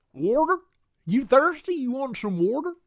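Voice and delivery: put-on high-pitched rural accent, questions swinging upward; put-on accent with "water" as "warter"; mock warter accent. Acts a warter speaker offering a drink.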